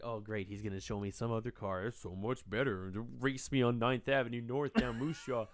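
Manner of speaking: low, soft deadpan sarcasm; sarcastic inner thought. Retold or imitated. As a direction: drop down into dry mock enthusiasm. His sarcastic inner thought retold.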